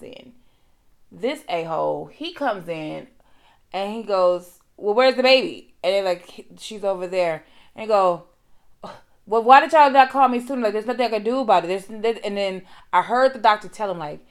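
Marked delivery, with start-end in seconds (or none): 4.8-5.7 loud brusque doctor. Retold or imitated. Doctor voiced in story. 9.3-11.7 loud, fast, high and annoyed; angry loud doctor. Retold or imitated. Doctor voiced, brushing her off.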